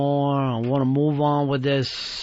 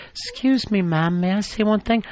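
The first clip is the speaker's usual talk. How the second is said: raised, mincing imitation of a meek producer; mock a meek request in a higher voice; mock prissy little voice; 3 semitones higher than usual. Host mocking the producer's timid request to speak, mock prissy voice. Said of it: "like you're in a little fucking dress".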